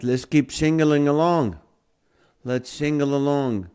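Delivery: normal talking voice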